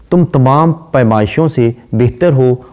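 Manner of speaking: ordinary talk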